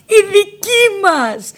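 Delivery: very high, slow "Η δική μας" in tearful joy; joyful cry. Retold or imitated. Nina hugs the officer, crying out high in joy. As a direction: sing out "Η δική μας" slowly, near tears. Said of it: "άρχισα να φωνάζω έξαλλη από χαρά".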